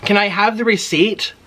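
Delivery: high, rising polite request again in the same sing-song; overly polite, sing-song request; sweet polite ask. Retold or imitated. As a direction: repeat the polite lilting ask. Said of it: "I said it like that".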